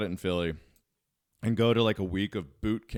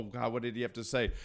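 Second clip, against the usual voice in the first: ordinary talk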